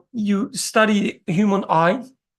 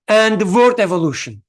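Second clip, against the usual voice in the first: ordinary delivery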